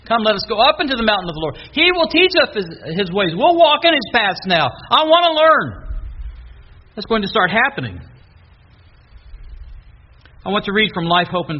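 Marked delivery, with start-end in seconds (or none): 0.0-5.8 fast, then much higher and louder; rally the crowd with rising eagerness; eager call. Acted. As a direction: pilgrims urging each other up the mountain, eagerly. People eagerly heading to Jerusalem.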